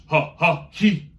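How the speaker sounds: loud ha ha he; punched syllables. Shown as a demonstration. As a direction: pop each syllable. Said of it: "show you what to do".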